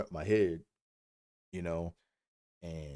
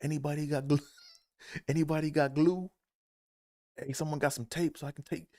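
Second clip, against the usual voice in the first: higher, desperate asking around; pleading for glue; 7 semitones higher than usual. Retold or imitated. Derek begging the equipment staff for glue, pitched high. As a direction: beg everyone around for glue. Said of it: "he's like".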